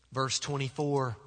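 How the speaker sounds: ordinary talk, nothing marked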